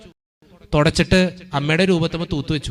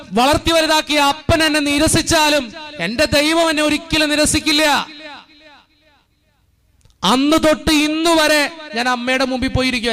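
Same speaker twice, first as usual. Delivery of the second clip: raised, insistent preacher's voice, building line by line; fervent preaching; 7 semitones higher than usual. Real, not acted. Priest urging the congregation in church, full fervent preaching. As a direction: drive each line home to the faithful.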